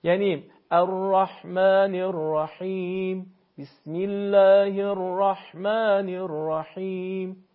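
slow recitation, ح in الرحمن الرحیم breathed like warming the hands; correct ح. Shown as a demonstration. Teacher modelling ح for a caller: slow recitation with a warm-breath ح.

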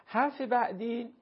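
ordinary delivery

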